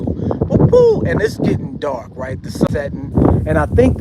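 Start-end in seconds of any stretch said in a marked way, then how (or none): 0.0-1.0 high hooting bird call, whoop woo; imitated bird call. Camper imitating the strange birds heard in the woods, high hooting call. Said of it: "coming from my left too".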